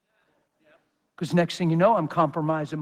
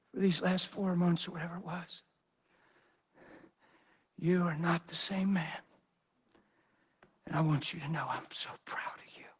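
dad's soft words; 11 dB quieter than usual. Retold or imitated. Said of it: "and he's crying".

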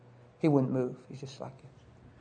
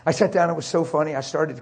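ordinary talk, nothing marked

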